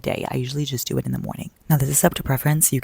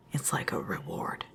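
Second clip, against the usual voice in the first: whispering; 7 semitones lower, 11 dB quieter, slower than usual. Acted. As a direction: lower soft whisper. Whispered secret confession.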